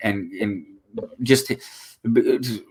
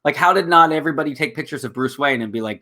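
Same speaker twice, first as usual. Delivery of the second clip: normal talking voice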